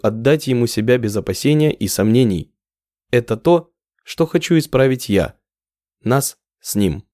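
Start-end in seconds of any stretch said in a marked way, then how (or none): none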